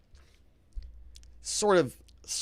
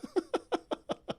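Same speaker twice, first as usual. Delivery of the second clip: high, soft run of giggles; quiet helpless laughter; real laugh; 6 dB quieter than usual. Real, not acted. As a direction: chuckle in disbelief. Laughs at chat comment.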